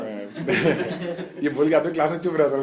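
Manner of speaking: amused, talking through laughter; laughing. Real, not acted. Teacher joking with the class, laughing. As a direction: let the laugh run into the words.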